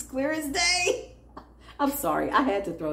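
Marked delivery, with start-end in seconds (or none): 0.0-1.1 mock-triumphant, rising to a high cry; playful crowing. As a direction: leap up high into a drawn-out wail at the end. Playful crow thrown into closing prayer.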